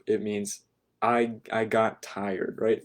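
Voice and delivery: normal talking voice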